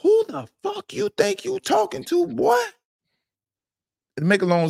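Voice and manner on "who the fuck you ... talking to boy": high, sharp comeback; indignant high-pitched challenge; confrontational retort. Retold or imitated. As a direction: snap back at the coach in disbelief. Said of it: "I said".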